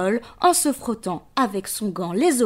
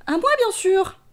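bee voice; 9 semitones higher than usual. Acted. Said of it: "fait l'abeille".

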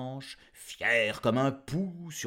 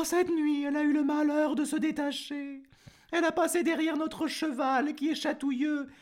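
much higher, put-on voice of a farm wife; sorry farm wife; 13 semitones higher, 5 dB louder than usual. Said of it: "dit la maîtresse". (voix aiguë et plaintive de la fermière qui pleure sa vache)

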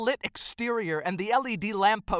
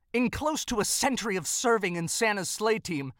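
ordinary delivery

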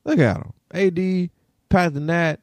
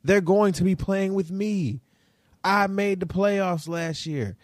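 quoting Beverley; 7 semitones higher than usual. Retold or imitated. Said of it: "Patrick Beverley said this".

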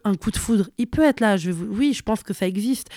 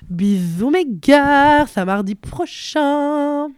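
playful sign-off voice; 4 semitones higher, 4 dB louder, much slower than usual. Real, not acted.

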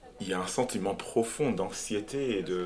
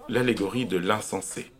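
usual speaking voice

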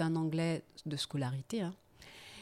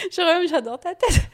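high, loud words through laughter; laughing; 10 semitones higher, 11 dB louder than usual. Real, not acted. Teasing the interviewer about her expression, speaking through laughter. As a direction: tease the listener while still laughing.

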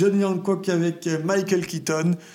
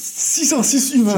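mock dramatic; 6 semitones higher, 5 dB louder than usual. Mock-dramatic echo of tiny number.

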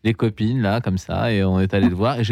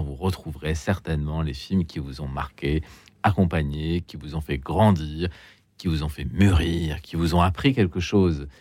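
radio host; 4 semitones lower, 3 dB quieter than usual. Acted. Host opens the radio show.

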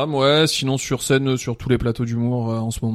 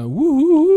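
high, loud wolf howl; 7 dB louder, much slower than usual. Pretend. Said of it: "Tu fais le loup ou un truc?".

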